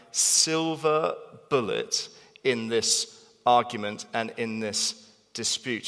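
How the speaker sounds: normal talking voice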